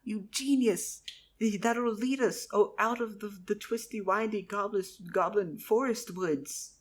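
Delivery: thrilled, praising his friend; cheering Charles for his clever idea; excited praise. Acted. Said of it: "and I went".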